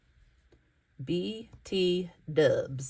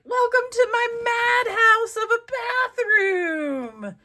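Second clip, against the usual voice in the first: much higher, louder, theatrical hostess voice; lady's welcome; 7 dB louder than usual. Pretend. Crafter voicing the collage lady greeting guests, hammed-up high. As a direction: ham up a grand welcome into the madhouse. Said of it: "And she's like".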